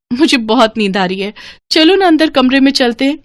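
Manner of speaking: playful, insistent new wife; coaxing witch bride. Acted. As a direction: coax him while dodging his request.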